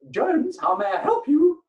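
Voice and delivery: high, loud, slow sing-song phone greeting; hammed-up receptionist answering the phone; mock receptionist. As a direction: chirp it out like a bored receptionist.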